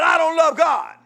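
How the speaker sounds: high shouted misquote; mock shouted misquote. Pastor mocking how people twist his words, shouting high. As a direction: yell it as the twisted version. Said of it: "[name] said".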